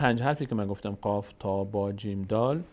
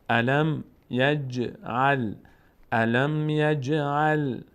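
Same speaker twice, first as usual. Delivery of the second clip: slow drawn-out tajweed chant; Quran recitation; 3 semitones higher, 3 dB louder, much slower than usual. Shown as a demonstration. Teacher reciting the verse for the class. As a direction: recite الم یجعل slowly and evenly.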